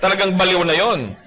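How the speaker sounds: ordinary talk, nothing marked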